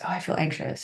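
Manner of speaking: whispered, small and worried; anxious whisper. Retold or imitated. Therapist voicing an anxious inner thought, whispered.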